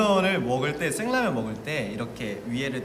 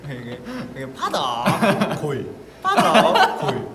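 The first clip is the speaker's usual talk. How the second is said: Psyduck voice; 6 dB louder than usual. Shown as a demonstration. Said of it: "여기 붙이고 다닐까".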